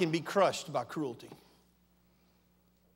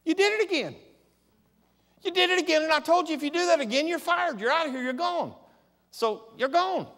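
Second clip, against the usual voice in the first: a pitched-up, slightly louder retold firing; firing them; 11 semitones higher, 4 dB louder than usual. Pastor firing staff, retelling in a raised voice. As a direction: lay down the verdict, a bit heated. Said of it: "I said".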